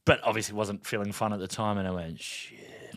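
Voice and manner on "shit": a breathy whispered "shit" under the breath; whispered curse. Retold or imitated. A whispered curse to himself as the police pull him over. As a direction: curse to yourself so nobody hears. Quiet dismay at being pulled over.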